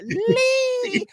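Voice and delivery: put-on high, squeaky little girl saying Lee; toddler voice. Dad imitates his little daughter greeting Uncle Lee, squeaky and high. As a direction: play a toddler trying a name. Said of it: "And my daughter's like".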